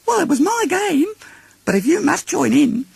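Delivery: very high, grudging little joey; grudging Roo. Acted. Roo lets them join his game, high and grudging. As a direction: allow it like a child sharing his game.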